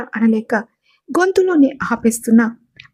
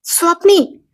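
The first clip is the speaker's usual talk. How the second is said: sharp call; 6 semitones higher, slower than usual. Acted. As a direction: stop her panic with one cry. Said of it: "గట్టిగా".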